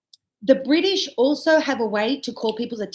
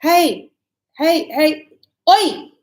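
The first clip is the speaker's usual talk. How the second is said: loud, higher hey, hey, oi; frustrated shout; 5 semitones higher, 8 dB louder, much slower than usual. Shown as a demonstration. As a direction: call out hey and oi to get noticed. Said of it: "I'm getting a bit frustrated".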